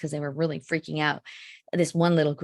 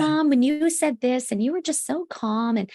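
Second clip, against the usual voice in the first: high, quick lightly voiced teen; fond recollection, a hint of a grown daughter; imagined grateful teen; 6 semitones higher than usual. Future daughter thanking her mom, lightly voiced and high. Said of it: "they'll say something like".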